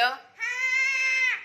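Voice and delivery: high, loud shouted yes; loud yes on request. Real, not acted. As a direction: belt out the answer when told to speak up. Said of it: "जोर से बोलो".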